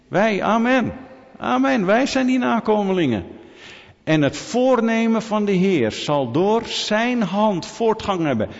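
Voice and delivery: high, emphatic pulpit proclamation; preaching at full force. Real, not acted. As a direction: proclaim it fervently to the congregation. Preaches prophecy fulfilled at full force.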